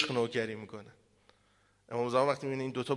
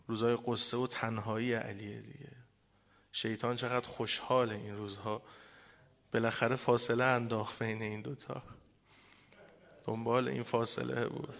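mourning recital. Acted. روزه رو بخونم.

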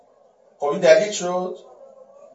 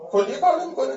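ordinary talk

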